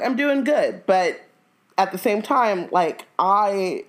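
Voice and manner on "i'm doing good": smug mom. Retold or imitated. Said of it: "she was like".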